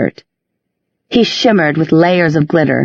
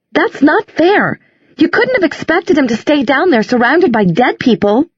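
indignant protest; 9 semitones higher than usual. A narrator's reading. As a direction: plead against the injustice. Isabel protests to Inquisitor.

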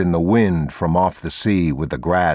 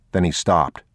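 ordinary delivery

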